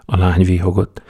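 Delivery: ordinary delivery, nothing marked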